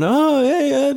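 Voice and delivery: high-pitched imitation of pals saying hello; friends' greeting. High school friends greeting him, imitated high. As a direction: lift the voice into an acted greeting. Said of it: "And I'm like".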